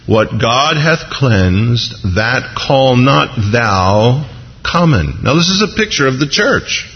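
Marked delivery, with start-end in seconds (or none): 0.1-5.2 very slow, deliberate pronouncement; solemn scripture reading. Acted. Voice speaking to Peter the second time, slow solemn reading. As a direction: pronounce it slowly, word by word. Reads the voice from heaven.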